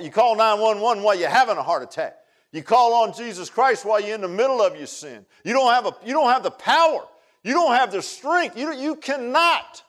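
loud, high preaching, slowing at the end; loud preacher insisting you cannot save yourself; full-force preaching. Acted. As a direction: hammer it home from the pulpit. Preaching climax on sin.

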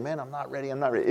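a believer doubting himself, softly and nervously; doubtful murmur. Retold or imitated. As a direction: mutter it like you've lost your nerve. Voicing an anxious self-doubter.

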